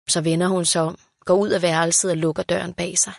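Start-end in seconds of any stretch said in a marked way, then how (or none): none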